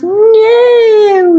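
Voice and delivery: very high, loud mock shout; hammed-up shout. A narrator's reading. Reader voicing Dumbledore shouting no at Snape. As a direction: yell the no like a cartoon. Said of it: "he shouted".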